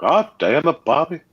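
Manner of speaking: high-pitched exasperated cartoon Texan dad; King of the Hill imitation. Still doing the King of the Hill bit, high exasperated Texan dad. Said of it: "You do an awesome imitation".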